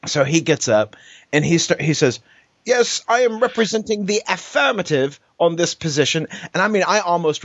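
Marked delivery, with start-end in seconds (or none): 2.6-6.3 mock posh British debater, pitched well up; lightly hammed British schoolboy; British accent. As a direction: imitate the British kid, high and proper. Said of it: "probably wouldn't have gone with the British accent".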